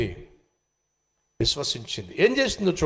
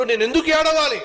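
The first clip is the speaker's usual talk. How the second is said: higher again, peak on ఏడవాలి; second take, ఏడవాలి pushed highest and louder; triumphant question; 8 semitones higher, 3 dB louder, faster than usual. Acted. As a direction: lift ఏడవాలి high in triumph. Hannah's new faith voiced.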